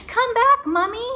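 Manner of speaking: high, loud call. A narrator's reading. Annie calling after the mummy, loud high call. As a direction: call into the dark pyramid. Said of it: "Annie called".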